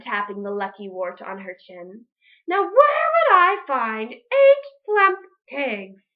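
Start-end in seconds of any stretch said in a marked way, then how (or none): 2.5-6.0 slow, high, loud witchy wondering; the witch muses aloud, drawn out. Acted. Witch Grinch plotting, high and slow.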